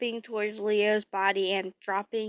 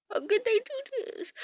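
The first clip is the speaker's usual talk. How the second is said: tearful; 12 semitones higher, 6 dB quieter than usual. Real, not acted. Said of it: "I'm getting emotional".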